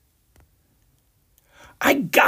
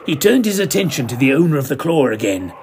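ordinary delivery

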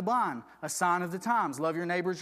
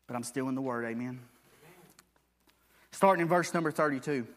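normal talking voice

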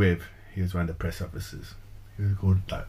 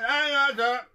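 very high squeaky prank voice; silly squeaky voice; slower than usual. Pretend. Prank caller funny voice.

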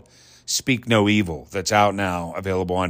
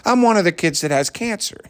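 put-on high-pitched, innocent kid voice; cute little boy; 10 semitones higher than usual. Quoting the boy on TV, cute and high. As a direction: imitate a proud little boy, pitched well up. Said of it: "So he says".

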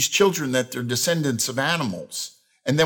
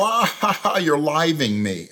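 re-enacted laughter; 3 semitones higher than usual. Preacher re-enacting laughing with his altered phrase, mock hearty laughter. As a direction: laugh it out as a deliberate catchphrase. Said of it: "I would sit there and laugh".

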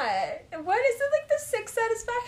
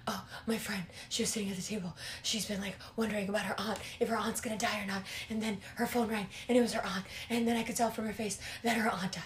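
whispered, soft and breathy retelling; whispered imitation of the Virgo on the phone; mock breathless whisper; 9 semitones lower, 13 dB quieter, faster than usual. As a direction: hush it like a shaken caller. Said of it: "He's, like, out of breath".

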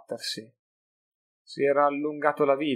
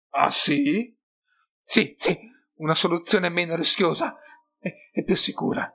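voce da skaven; 6 semitones higher, 5 dB louder than usual. A narrator's reading. Skaven intrigante parla d'un piano.

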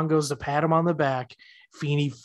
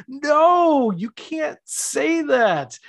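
much higher, slow panicked protest; mock panic; 11 semitones higher, 4 dB louder, much slower than usual. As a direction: cry out in horrified protest. [name] panicking at Corey.

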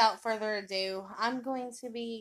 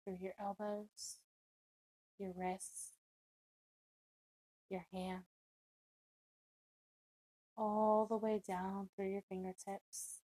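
guided meditation; 7 dB quieter than usual. Acted. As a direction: ease the listener down into calm, unhurried.

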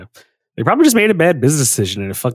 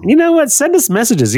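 sudden excited; 11 semitones higher than usual. Real, not acted. Hyping listeners to send messages, sudden and high. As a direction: jump in suddenly, high and eager.

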